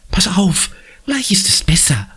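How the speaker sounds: gentle whisper; soothing whisper. A narrator's reading. Conny soothing her bleeding brother, gentle whisper.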